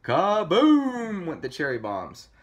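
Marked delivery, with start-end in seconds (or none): 0.0-1.2 loud, high, stretched-out blast; booming explosion. A narrator's reading. Cherry bombs going off, big drawn-out boom. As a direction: let the word explode, slowly.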